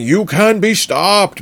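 slow, high yelled threat; mild mock yell; screaming threat. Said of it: "Bartholomew is screaming".

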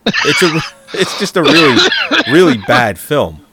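loud laughing rant; laughing loudly. Real, not acted. Laughing rant about bad film.

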